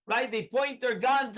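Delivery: ordinary delivery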